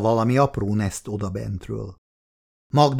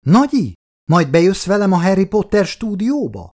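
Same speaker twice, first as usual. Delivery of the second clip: put-on high, eager child asking her grandma; little girl voice; 8 semitones higher, 4 dB louder than usual. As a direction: voice a small girl begging for a treat. Child Olenka asks grandma.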